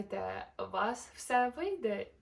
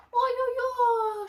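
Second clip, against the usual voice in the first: high, loud, slow wailing oh-oh-oh; panicked koala yelp; frightened cry; 14 semitones higher, 12 dB louder, much slower than usual. Acted. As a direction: cry out as the branch cracks. Branch breaking.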